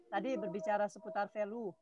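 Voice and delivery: faint, distant voice; speaking too far from the mic. Real, not acted. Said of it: "terlalu jauh suaranya".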